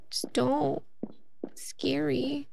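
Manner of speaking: whispered, slow, to the dog; soft pleading whisper. Retold or imitated. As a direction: hush it as a worried owner. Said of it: "And I'm like".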